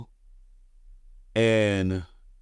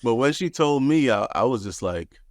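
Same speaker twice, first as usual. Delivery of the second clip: ordinary talk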